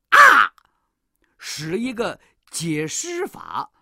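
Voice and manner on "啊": short high loud scream; demon's scream. A narrator's reading. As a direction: shriek as if struck. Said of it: "那妖怪喊了一声". (妖怪一声尖叫)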